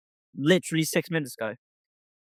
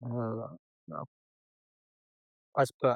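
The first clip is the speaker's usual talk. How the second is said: ordinary talk, nothing marked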